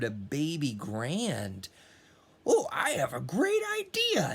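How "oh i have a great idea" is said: much higher, fast rooster voice; excited rooster. Acted. Rooster hit by a great idea, high excited voice. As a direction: pop the idea out like a cocky rooster. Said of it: "he said".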